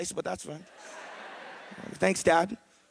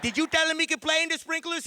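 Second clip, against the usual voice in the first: mom's question pitched way up; high mom voice; 9 semitones higher than usual. Retold or imitated. Comic voicing his mom grilling dad, pitched high. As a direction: squeal it like an outraged mom. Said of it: "She's like".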